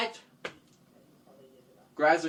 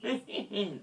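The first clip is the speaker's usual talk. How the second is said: soft evil chuckle; villain laugh; 4 semitones higher, 10 dB quieter than usual. Acted. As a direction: chuckle quietly, plotting. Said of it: "Graciana said".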